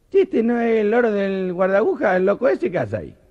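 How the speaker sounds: put-on Argentine accent. Train drivers spotting the parrot, high Argentine accent. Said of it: "contarlos con acento argentino".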